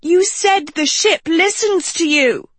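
high, squawking complaint. A narrator's reading. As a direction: squawk it out in protest. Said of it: "Sophie squawked".

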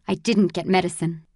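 ordinary delivery

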